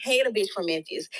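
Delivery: normal talking voice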